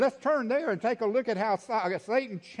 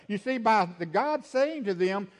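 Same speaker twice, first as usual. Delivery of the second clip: ordinary delivery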